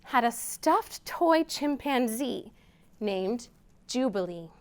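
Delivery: gentle picture-book read-aloud; storytime reading. A narrator's reading. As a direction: read it slowly to little listeners.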